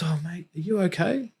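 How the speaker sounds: high, caring voice of the mum; gently asking a crying stranger if he's alright; concerned lady. Retold or imitated. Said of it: "and she goes".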